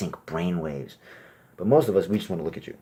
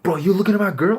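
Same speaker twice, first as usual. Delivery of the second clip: high, loud challenge; jealous bro; 12 semitones higher, 8 dB louder than usual. Retold or imitated. Boyfriend confronting a guy staring at his girlfriend, high and loud. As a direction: confront him over your girl.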